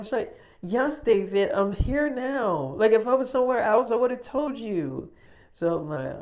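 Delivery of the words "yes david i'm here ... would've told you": high, loud exasperated retort, imitated. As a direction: mimic someone annoyed at being doubted, stretching the key words. Caller annoyed at being doubted.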